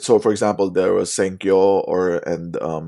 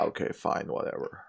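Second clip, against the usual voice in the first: whispered, grudging okay fine whatever; dismissive whisper; 11 dB quieter than usual. Real, not acted. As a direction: brush it off under your breath. Dismissive aside.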